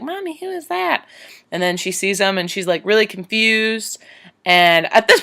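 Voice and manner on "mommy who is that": put-on much higher, softer child's question; little girl voice. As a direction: ask like a curious small child. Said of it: "the little girl's like".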